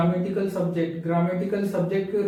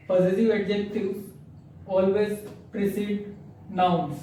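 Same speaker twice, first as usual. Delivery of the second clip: slow dictation; much slower than usual. Shown as a demonstration. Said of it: "teacher reads rule slowly".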